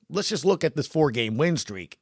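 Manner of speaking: ordinary talk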